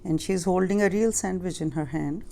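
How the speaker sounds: plain reading voice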